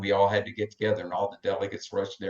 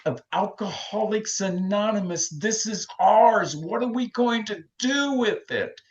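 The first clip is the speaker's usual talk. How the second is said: much higher, slow, fervent exhortation; passionate preaching; 11 semitones higher than usual. Acted. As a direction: rouse the room to care. Preaching at full force.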